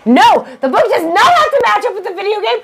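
high, indignant mock outburst; mock rant. Fan ranting at the video game delaying the book, high and indignant. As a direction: rail against the game tie-in.

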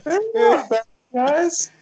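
high, slow put-on voice; mock robot voice. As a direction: sound like a friendly machine. Joke defending the robots.

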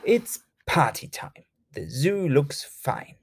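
plain reading voice

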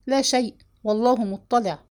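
ordinary delivery

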